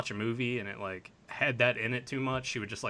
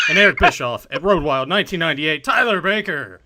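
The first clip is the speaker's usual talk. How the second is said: louder, higher ring-announcer finish; loud build-up; 3 semitones higher, 11 dB louder than usual. Acted. Host introducing Tyler Baker, rising announcer shout.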